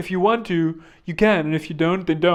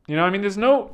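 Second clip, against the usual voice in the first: ordinary talk, nothing marked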